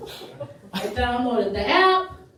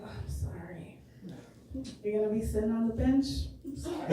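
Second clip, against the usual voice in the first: very soft, hushed fake apology; mock sympathy; 2 semitones lower, 9 dB quieter, faster than usual. Teacher consoles crying boys cut from the team, hushed mock pity. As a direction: drop right down in volume to console them.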